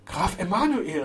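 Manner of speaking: high exclamation of recognition; calling out. A narrator's reading. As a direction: call out the name in surprise. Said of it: "rief er".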